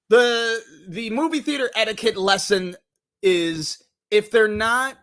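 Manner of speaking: ordinary talk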